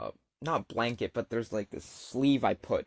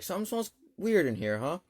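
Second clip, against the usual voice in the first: higher-pitched mock-casual hint; mom playing casual; 7 semitones higher than usual. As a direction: imitate mom slipping in a pointed remark. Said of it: "tries to play it casual".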